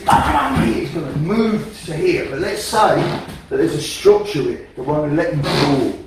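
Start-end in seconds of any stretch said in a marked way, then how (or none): 0.0-0.7 sharp loud shout of bam, pitched high; loud demo shout. Shown as a demonstration. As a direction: bark it out like landing a strike. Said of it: "So, if I threw this".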